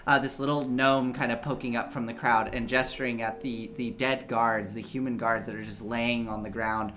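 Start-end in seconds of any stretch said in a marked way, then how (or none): none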